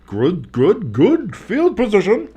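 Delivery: pitched well up, slow and plummy Victorian British gent; Victorian British man. Pretend. Sports caller calling field position as Victorian British gentleman. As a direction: slip into a posh old-timey Englishman mid-call. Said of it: "I turned into a Victorian British man".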